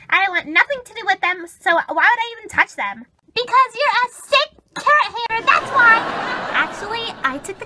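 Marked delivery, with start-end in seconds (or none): 3.3-6.0 raised, emphatic retort; heated accusation. Acted. Midge blaming Becky for missing carrots, heated retort. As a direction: fire it back, louder at the end.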